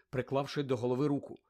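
plain reading voice

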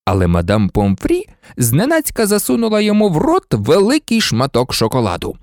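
plain reading voice

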